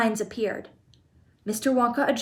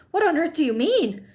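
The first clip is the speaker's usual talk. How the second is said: higher, sharp demand; sharp question; 7 semitones higher than usual. A narrator's reading. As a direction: snap it at Wonka. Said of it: "asked Mister T V sharply".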